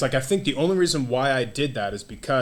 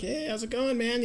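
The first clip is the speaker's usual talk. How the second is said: cheery greeting; 11 semitones higher, 3 dB quieter than usual. Retold or imitated. Feeling like life of party.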